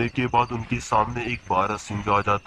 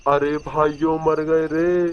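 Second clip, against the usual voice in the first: loud, high hammed-up wail of terror; panicked yelling; 6 semitones higher, 7 dB louder than usual. Coward yelling to friends as stones fall, panicked high shout. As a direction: cry out in panic at full pitch. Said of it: "शोर मत मचाओ".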